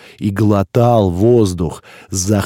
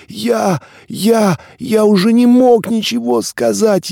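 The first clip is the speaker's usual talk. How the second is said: much higher strained gasping voice; choking; 13 semitones higher than usual. A narrator's reading. Suffocating newcomer struggles to speak, strained and gasping. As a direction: squeeze the words out, no air. Narrator chokes, cannot speak.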